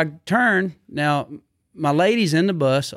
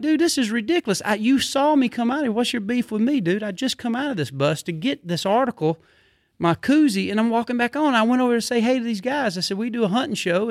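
retold mad rant; 7 semitones higher than usual. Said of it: "I get mad".

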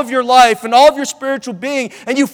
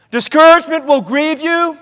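higher-pitched first half of a contrast; raised warning; 5 semitones higher than usual. Real, not acted. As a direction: lift the voice and leave it hanging on "grieve".

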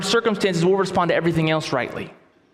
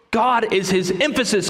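usual speaking voice

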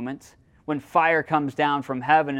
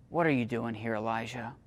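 gentle whisper; 4 semitones lower, 6 dB quieter, faster than usual. Acted. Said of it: "came a gentle whisper".

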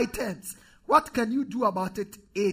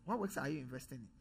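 low, much softer, fast repeat of the question; hushed echo of the challenge; quiet repeat; 7 semitones lower, 13 dB quieter, faster than usual. Real, not acted. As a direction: drop it almost to an aside.

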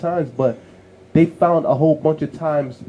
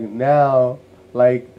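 usual speaking voice

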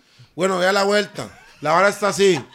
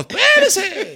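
high-pitched shouted halt; calling out; 12 semitones higher, much slower than usual. Real, not acted. Host cuts the others off, calling out. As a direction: yell it high to stop everyone.

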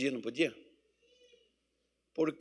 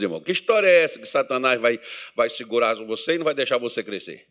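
ordinary talk, nothing marked

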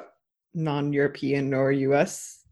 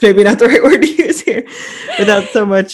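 laughing; 5 semitones higher, 10 dB louder than usual. Real, not acted. Laughs at own wrong word.

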